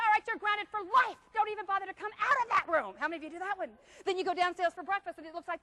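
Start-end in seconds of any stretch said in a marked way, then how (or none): 0.1-2.9 scolding parent. Acted. Parent scolding in acted scene.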